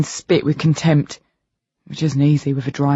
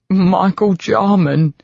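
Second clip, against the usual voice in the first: laughing; 4 semitones higher, much slower than usual. A narrator's reading. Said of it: "I laugh".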